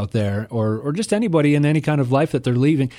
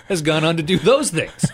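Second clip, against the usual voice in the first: higher-pitched, laughing through the joke; joking with a laugh in the voice; amused, laughing; 6 semitones higher than usual. Real, not acted. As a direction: let laughter break into the words. Joking about singer as killer.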